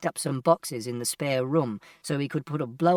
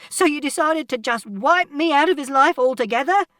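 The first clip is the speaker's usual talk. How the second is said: very high, loud, outraged question; furious shout; 11 semitones higher, 7 dB louder than usual. A narrator's reading. Mother accusing father over her son, shouting furiously. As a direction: yell the accusation in disbelief. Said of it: "And Mother shouted".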